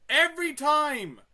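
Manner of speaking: loud, high cry of exasperation; frustrated yell. Real, not acted. At the game after another fail, a high furious yell. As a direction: shout at the game. Failing again.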